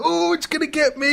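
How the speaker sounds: very high, hammed-up old man's wail; mock panic. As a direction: wail it like a fleeing pensioner. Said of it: "And he's like".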